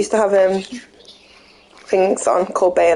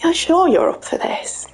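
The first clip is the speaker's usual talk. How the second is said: hushed, concerned partner; whisper; 9 semitones higher, slower than usual. Acted. Voicing the detective partner.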